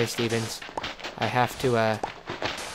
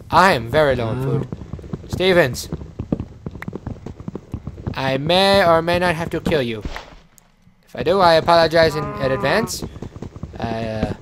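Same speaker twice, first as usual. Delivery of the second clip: higher and louder, hammed-up regret; mock solemn apology; 4 semitones higher, 4 dB louder than usual. Apologising to the sheep he may eat, mock-grave. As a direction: apologise like a tragic hero, tongue in cheek.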